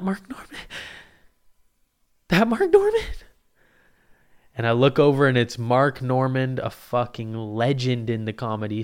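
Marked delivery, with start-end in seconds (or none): 0.0-3.2 squeaks way up high and loud in disbelief; can't believe who it is; stunned disbelief. Retold or imitated. Comic seeing his hero walk in, squeaky disbelief.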